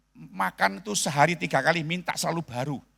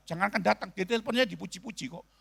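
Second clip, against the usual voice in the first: ordinary delivery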